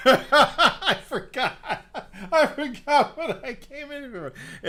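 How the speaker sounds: high, loud laughing words; can barely get it out for laughing. Real, not acted. Comic laughing about forgetting in kitchen.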